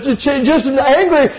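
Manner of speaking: ordinary talk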